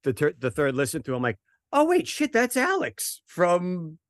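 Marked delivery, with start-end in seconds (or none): 1.7-4.0 high-pitched, drawn-out amazement; retold astonished realization. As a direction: relive the moment it dawns on you, voice shooting up. Retells sudden realization about Alex.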